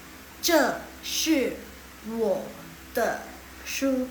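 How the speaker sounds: slow, syllable by syllable Mandarin; slow model reading. Shown as a demonstration. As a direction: read it out slowly for the class. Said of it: "bantu Laoshi ketik pinyin-nya". (membaca pelan untuk murid)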